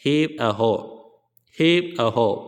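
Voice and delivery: mild mock work call; sailors' shout. A narrator's reading. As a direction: call it out like hauling sailors, half-hearted. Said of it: "हर संदूक के ऊपर आने पर चिल्लाते". (मल्लाहों जैसी हल्की पुकार)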